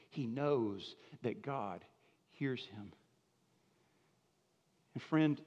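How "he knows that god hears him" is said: hushed awe. Acted. Soft emphasis on assurance.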